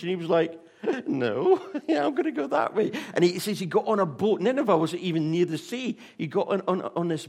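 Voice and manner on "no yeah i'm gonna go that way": high, laughing mock reply; laughing refusal. Jonah laughing off God's order, high and mocking. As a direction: laugh it off and head the other way.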